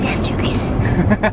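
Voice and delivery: slow whispered superhero growl; Batman whisper. Pretend. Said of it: "Why are you talking like that?".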